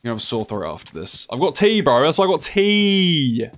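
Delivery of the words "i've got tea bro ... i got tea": high, loud protest; loud comeback. Real, not acted. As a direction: holler it back at the teaser. Answers sore throat remark, yelling.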